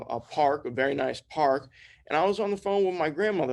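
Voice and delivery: usual speaking voice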